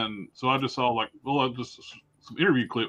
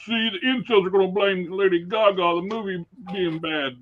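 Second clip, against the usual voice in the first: higher, sing-song mimicry of online idiots; mocking strawman; 6 semitones higher than usual. Imitating idiots defending the movie, mocking strawman voice. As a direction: parody a silly argument, drawing out the end. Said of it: "strawman arguments".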